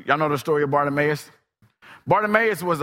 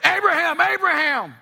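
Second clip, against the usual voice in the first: high ringing call of the name; angel calling; 5 dB louder than usual. Acted. Angel stops Abraham's sword, high urgent call. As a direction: call him from above, urgent.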